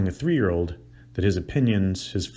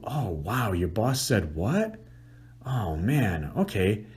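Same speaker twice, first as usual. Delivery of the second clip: empathetic listening; much slower than usual. Shown as a demonstration. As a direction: sound shocked on her behalf. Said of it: "can you hear it in my voice?".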